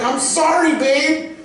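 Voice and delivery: high-pitched defensive apology; retold apology to wife. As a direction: plead your case to the wife, pitched high. Retold excuse to scolding wife.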